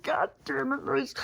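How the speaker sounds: high-pitched strained scolding without volume; exasperated, not yelling. Retold or imitated. Dog walker cursing at Maurice on the street, high exasperation. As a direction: squeak out frustration, holding back the yell. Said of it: "I'm not yelling".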